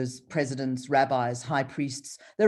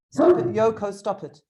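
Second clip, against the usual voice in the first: louder, sharp call to the dog; scolding the dog; 5 semitones higher, 7 dB louder than usual. Real, not acted. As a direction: tell the dog to stop, loud.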